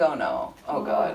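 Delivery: woman alone fearing the angry stranger; whispered panic. Retold or imitated. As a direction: gasp it under your breath in fright. Fear of angry man on platform.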